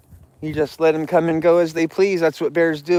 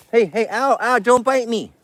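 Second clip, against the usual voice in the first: high-pitched ouch to an animal; playful yelped protest; 6 semitones higher than usual. Real, not acted. Man being nipped by a bear, high mild yelps. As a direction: protest the nip in a raised pet-talk voice.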